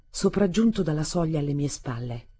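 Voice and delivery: ordinary delivery, nothing marked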